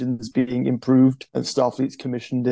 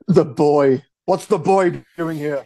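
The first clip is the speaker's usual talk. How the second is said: indignant imitation of a stern captain, raised voice; hammed-up scolding, pitched up; mock anger; 3 semitones higher, 4 dB louder, slower than usual. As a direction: play-act outrage at an unwanted kid. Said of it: "they take their anger out on Wesley".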